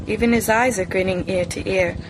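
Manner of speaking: ordinary delivery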